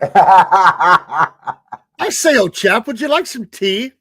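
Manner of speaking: laughing, hammed-up British accent; mock posh British. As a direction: play a stuffy English gent offering tea. Mocking northern tea fuss.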